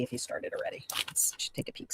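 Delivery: hushed whisper while checking the other stream; soft aside whisper. Real, not acted. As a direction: whisper it under your breath.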